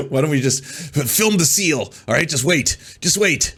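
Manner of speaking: high mocking voice for a TV producer. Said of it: "they're like".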